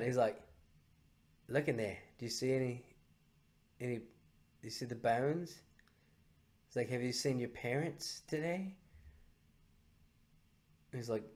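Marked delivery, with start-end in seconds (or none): 1.5-5.6 low, hushed, sinister questioning; Cartman. Acted. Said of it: "And Cartman's like". 6.9-8.7 very soft, menacing cartoon voice; hushed question with a threat underneath; Cartman. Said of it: "And Cartman's like".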